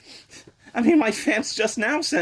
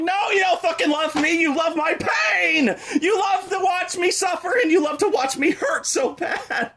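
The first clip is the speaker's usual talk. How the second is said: mock protest; 7 semitones higher than usual. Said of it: "I'm like".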